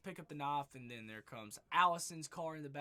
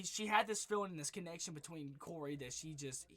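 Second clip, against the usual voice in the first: ordinary delivery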